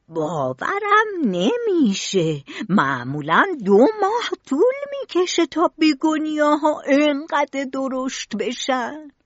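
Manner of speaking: amazed, drawn-out start climbing high; astonished. A narrator's reading. Gardener amazed at the grown begonias, astonished. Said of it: "سیبیلو با تعجب گفت".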